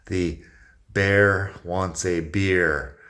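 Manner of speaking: "bear wants a beer" said very slowly; teacher dictating the sentence; very slow model sentence. Shown as a demonstration. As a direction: space out each word for learners. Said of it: "algo como que el oso quiere una cerveza".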